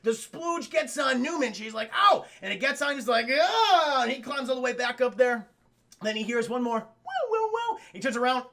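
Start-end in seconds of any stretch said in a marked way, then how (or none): 1.9-2.2 loud, high ow; mock pained cry. Acting Newman hit by venom. 3.3-4.0 loud, high ow; mock pained cry. Acting Newman hit by venom. 6.8-7.8 high hooting chirp; little creature's high warble; imitated dinosaur call.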